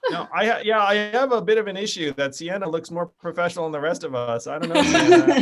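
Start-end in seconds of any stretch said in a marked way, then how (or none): 4.5-5.4 high, loud laughing tease. Real, not acted. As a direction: tease her through a laugh. Teasing about her suit.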